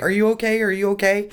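lightly voiced passers-by checking in with alarm; worried pilgrims. Pilgrims rushing over to the sobbing walker, mildly mimicked concern. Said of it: "The pilgrims were like".

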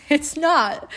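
a short laughing "It's not."; amused little repeat. Real, not acted. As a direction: laugh it off as you say it again. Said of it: "It's not that crazy".

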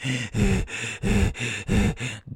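rhythmic, heaving gasps for air; mock dying gasps. Firefighter candidate on the last set, mock dying gasps. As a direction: gasp like you're spent.

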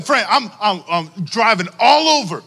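normal talking voice

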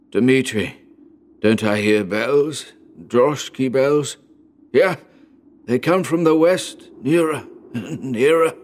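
excited dying father. A narrator's reading. Dying father tells son of bells, with feverish hope. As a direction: strain eagerly toward a far-off sound.

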